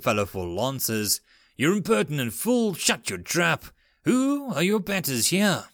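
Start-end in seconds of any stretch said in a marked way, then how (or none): 1.6-5.7 growling. Acted. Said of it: "Commander Ellison growled at Lucia".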